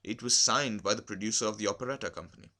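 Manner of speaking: plain reading voice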